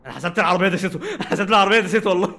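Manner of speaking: talking through real laughter; laughing. Real, not acted. Streamer laughing after thinking the car ran her over. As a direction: laugh it out in disbelief.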